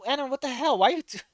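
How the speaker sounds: high-pitched, stammering disbelief; baffled outburst. Real, not acted. Player blurting "what are you talking about" at the game, incredulous. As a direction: cut yourself off, baffled, pitch leaping up.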